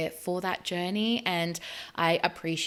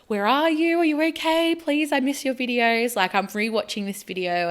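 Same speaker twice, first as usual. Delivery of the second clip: worried fan; 6 semitones higher, 6 dB louder than usual. Retold or imitated. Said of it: "someone would come on and be like".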